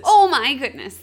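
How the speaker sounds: loud shocked exclamation. Real, not acted. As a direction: blurt it out in disbelief. Reacting to two penises twist.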